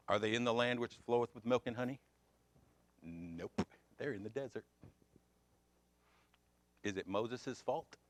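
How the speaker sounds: ordinary talk, nothing marked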